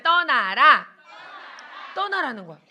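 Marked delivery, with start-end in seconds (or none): 0.0-0.9 put-on loud command for children to echo; call to repeat. Shown as a demonstration. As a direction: call it out firmly for the class to repeat. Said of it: "따라해볼게요".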